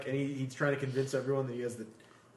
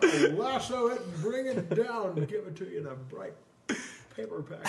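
mock Jimmy Stewart; 6 semitones higher than usual. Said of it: "Jimmy Stewart".

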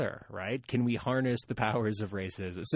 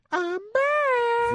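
very high, squeaky laughing quote; movie quote voice; 6 dB louder than usual. Pretend.

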